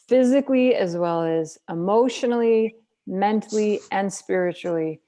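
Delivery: physically shouted high and loud, then easing down; emphatic burst. Acted. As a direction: fire the list out with passion, then settle. List repeated with rising force.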